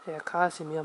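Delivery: put-on girl grudgingly obeying in a murmur; muttering. A narrator's reading. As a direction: mumble it under your breath, shaking your head. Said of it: "murmelte ich kopfschüttelnd".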